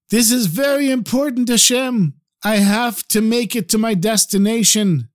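high, pleading cry to God; crying out to heaven. Acted. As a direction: call up to heaven, begging for help. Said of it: "he cried out to heaven".

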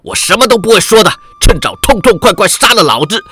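fast, rising to a high, loud roar; defiant roar. Acted. Captive hurls defiance at his captor, roaring. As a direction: spit it out like a captive who won't break. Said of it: "对着步入房内的郑恒洲喝道".

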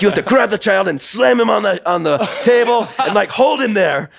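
high, loud mock Russian accent; hammed-up Russian coach, emphatic; Sergei Luzov. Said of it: "I don't know if I can do a good Russian accent".

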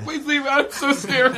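high, slow mock fright; mock scared. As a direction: squeak it like a scared bystander.

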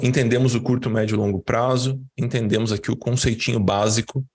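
normal talking voice

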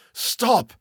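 high, sharp cry to halt; urgent high exclamation; thundering command. A narrator's reading. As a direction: cry out to stop a friend. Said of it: "Kurtz's voice thundered".

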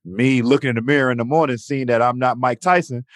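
normal talking voice

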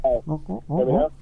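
muffled caller far from the mic; muffled phone. Real, not acted. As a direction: sound muffled, away from the phone. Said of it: "you're a little muffled".

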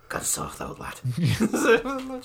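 put-on husky Scouse croak, mild Scouse accent; gruff Scouse. Scouse medium faking a ghost, husky Scouse. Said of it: "fifty-year-old Scouse man".

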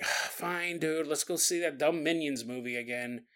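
soft, defeated giving in; resigned. Pretend. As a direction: sigh it out in surrender. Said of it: "you'd be like".

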